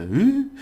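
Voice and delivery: high, loud rising "ooh"; exaggerated question rise. Shown as a demonstration. Teacher mimics the overdone intonation learners needn't do, exaggerated rise. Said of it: "I do that for emphasis".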